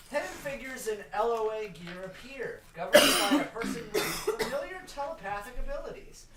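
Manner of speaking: high, loud epic storyteller voice; dramatic recap narration. Acted. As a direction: proclaim it like a fantasy herald. Game master dramatic recap narration.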